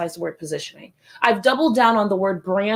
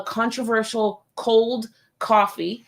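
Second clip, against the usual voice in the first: ordinary talk, nothing marked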